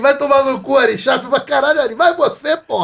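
loud, high mock bickering; retold squabble. Host re-enacts co-stars fighting on set, mock angry. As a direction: imitate two pals cursing each other, amused. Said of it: "ele tava brigando mesmo".

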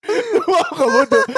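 high laughing through the word. Real, not acted. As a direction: laugh while swearing it. Swearing it's true, laughing. (sambil ketawa ngakak)

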